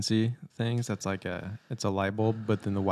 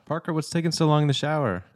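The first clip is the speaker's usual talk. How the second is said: higher, calling through a door; Gavin calling through door; 7 semitones higher than usual. Retold or imitated. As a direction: call out like an impatient brother. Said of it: "Gavin knocks on the door".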